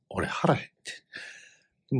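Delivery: a slow hungry whisper; slow whispered complaint. Shown as a demonstration. Telling the listener he was starving, slow whisper. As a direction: whisper it slowly, drawn out. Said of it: "俺、腹減ってんねん、".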